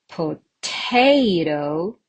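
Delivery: potato with TA stretched long and punched; poTAto, exaggerated middle stress; stress on second syllable. Shown as a demonstration. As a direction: hit the middle syllable of potato hard, slowly. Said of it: "Which one is correct?".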